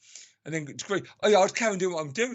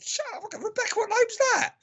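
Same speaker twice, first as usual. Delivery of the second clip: very high-pitched, exasperated squawk; indignant high-pitched mock rant; angry outburst. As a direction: re-enact losing your temper, voice shooting right up. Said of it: "Oh, I was so angry".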